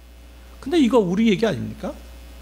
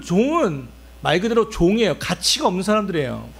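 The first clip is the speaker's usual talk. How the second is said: normal talking voice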